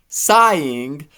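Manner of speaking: plain reading voice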